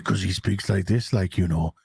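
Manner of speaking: very low, subdued impression; mock muted, low-pitched man. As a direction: drop right down into a muted murmur. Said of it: "when I say muted".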